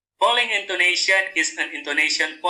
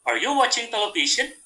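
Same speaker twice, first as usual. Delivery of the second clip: higher-pitched question, lifting at the end of "television"; rising intonation; 6 semitones higher than usual. Shown as a demonstration. Teacher models an interrogative sentence, rising intonation. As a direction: make "television" climb as a question. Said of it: "So the intonation is rising".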